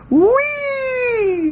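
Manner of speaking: very high, drawn-out whee; high squeal. Pretend. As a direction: let out a long squealed whee. Playful squeal closing the tangent.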